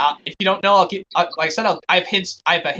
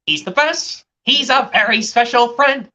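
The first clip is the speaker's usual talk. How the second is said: put-on higher-pitched children's show presenter; 5 semitones higher, 4 dB louder than usual. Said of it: "this is gonna be a Wiggles quote".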